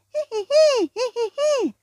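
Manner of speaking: very high squeaky hihihi; very high tittering laugh; squeaky creature giggle. Acted. Balli laughs at fart joke.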